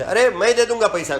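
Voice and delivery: calling loudly that he will pay; retold call out. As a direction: call out across the canteen, half-acted. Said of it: "બૂમ મારે છે".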